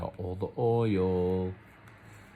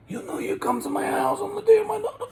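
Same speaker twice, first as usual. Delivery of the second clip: a hint of Brando, much higher, laughing through the words; Brando impression cracking up; 5 dB louder than usual. Attempting a Marlon Brando impression for viewers, laughing through it. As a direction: try the Godfather line but crack up. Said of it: "Should I do a Marlon Brando impression?".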